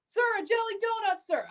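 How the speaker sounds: high, soft, quick recruit reply; timid recruit. Pretend. Recruit answering the drill sergeant, high and meek.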